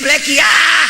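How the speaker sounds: loud, rough shout of a nickname; mock calling out. Husband yelling a rude nickname at his wife, loud shout. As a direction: bark the nickname across the house.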